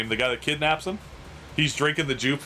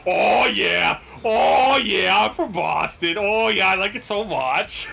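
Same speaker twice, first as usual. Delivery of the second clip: hammed-up Boston accent, higher and louder; exaggerated Boston accent, drawn out and moaning; mock Boston accent; 5 semitones higher, 5 dB louder, slower than usual.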